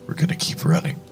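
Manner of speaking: plain reading voice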